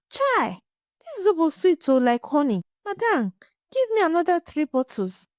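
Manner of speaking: high, thrilled market woman; delighted exclamation. Acted. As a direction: gush with sweet surprise. Delighted customer exclaims after sip.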